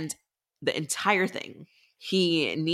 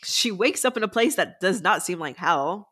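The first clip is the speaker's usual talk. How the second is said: ordinary talk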